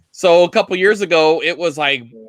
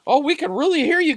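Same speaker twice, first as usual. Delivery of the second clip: imitating video guy; 11 semitones higher than usual. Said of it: "the guy's just like".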